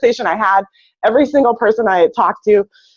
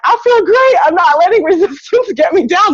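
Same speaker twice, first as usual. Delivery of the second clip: much higher, hammed-up cheerful bravado; mock chipper denial; 9 semitones higher than usual. Feverish [name] telling coworkers she feels great, squeaky mock cheer. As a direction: play the overeager sick girl insisting she's fine. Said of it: "And I was like".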